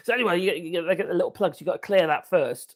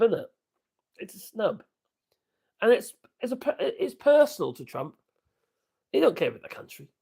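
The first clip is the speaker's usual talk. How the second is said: ordinary talk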